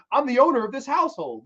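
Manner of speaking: high-pitched imitation of an affronted owner; mock indignation. Acted quarrel over a rented house.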